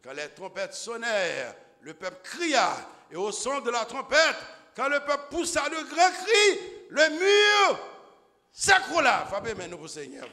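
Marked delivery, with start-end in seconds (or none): 1.8-10.3 loud, high fervent preaching; full-force preaching. Acted. Preacher driving home the fall of Jericho, fervent shout. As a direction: preach it to the rafters.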